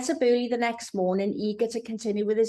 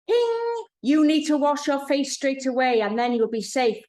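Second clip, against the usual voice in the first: higher, louder commanding order; an insistent voice in her head giving commands; commanding inner voice; 5 semitones higher, 4 dB louder than usual. A narrator's reading. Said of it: "comes the order".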